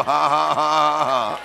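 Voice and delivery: loud, sustained, deliberate "ha ha ha"; defiant mock laugh. Shown as a demonstration. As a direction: answer the devil with a put-on laugh. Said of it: "You go".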